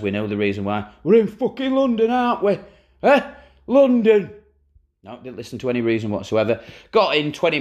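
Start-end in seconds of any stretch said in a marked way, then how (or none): 1.0-4.3 loud rough football manager rant; Warnock impression. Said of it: "as Warnock says".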